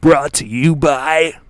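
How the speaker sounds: loud, drawn-out Australian announcer; big Australian sponsor read; Australian accent. Acted. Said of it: "my, uh, my Australian accent".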